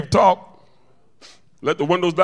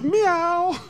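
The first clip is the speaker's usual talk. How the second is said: one high, drawn-out meow; imitated cat meow. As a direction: bird faking a cat's meow. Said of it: "the bird said".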